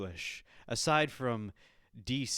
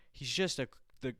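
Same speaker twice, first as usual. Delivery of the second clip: ordinary delivery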